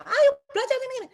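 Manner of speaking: high, loud scolding push to study; retold mother snapping at her kid; mom's angry nagging. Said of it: "saya selalu marah-marah".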